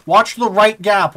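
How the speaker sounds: slow, loud callout; urgent shout. Real, not acted. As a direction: bark the warning slowly. Warning squad in battle.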